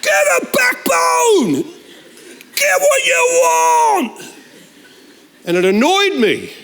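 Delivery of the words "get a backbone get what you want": very high, slow shouted command; husband yelling at his wife to toughen up, mock shout; comic shout. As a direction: bellow it at her, hammed-up.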